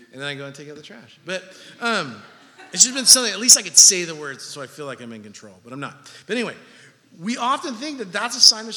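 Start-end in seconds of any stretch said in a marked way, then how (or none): none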